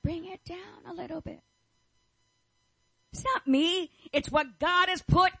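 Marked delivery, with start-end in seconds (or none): 0.0-1.4 a light imitation of people hushing, softer; mock a gentle shush; hushing listeners. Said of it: "now people are saying".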